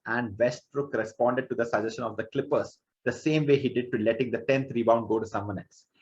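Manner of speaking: usual speaking voice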